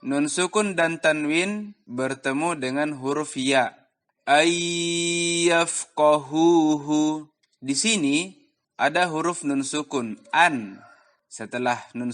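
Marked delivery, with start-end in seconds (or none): 4.3-7.3 long held nasal y in ayyafqahuhu; gunnah on ayyafqahuhu. Shown as a demonstration. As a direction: recite ayyafqahuhu slowly, holding the merged sound. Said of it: "dibaca dengan gunnah, ditahan".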